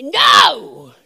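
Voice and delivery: full-force preacher's shout of No. Shown as a demonstration. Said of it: "you have to say".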